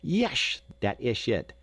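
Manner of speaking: put-on Yesh jumps high, eager Dutch boy; eager Dutch accent. A narrator's reading. Jacob eagerly confirms to Ben in a Dutch accent. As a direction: pounce on the word in a Dutch accent. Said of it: "said Jacob eagerly".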